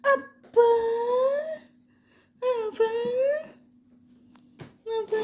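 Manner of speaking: very high warning to a pet; high, drawn-out exclamations of reproach; mock scolding. Real, not acted. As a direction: chide a naughty pet in a high voice.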